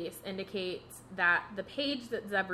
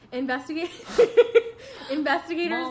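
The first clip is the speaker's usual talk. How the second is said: reading through giggles, higher and louder; real laughing; 9 semitones higher, 10 dB louder than usual. Real, not acted. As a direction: crack up mid-word and try to carry on. Cracks up while reading on.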